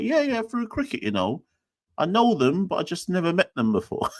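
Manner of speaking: high, sheepish, half-laughing self-quote; sheepish high mock voice. Telling colleague he knows people only online, sheepish high voice. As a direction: play it sheepish and awkward. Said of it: "I was like".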